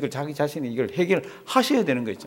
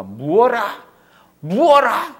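rising, louder cry of outrage; mock indignant shout climbing higher; indignant outburst; 6 semitones higher, 5 dB louder, much slower than usual. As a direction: blurt it out like someone jealous and offended. Acts out an envious outburst.

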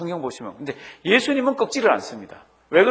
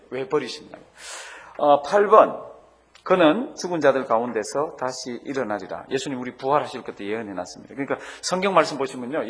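normal talking voice

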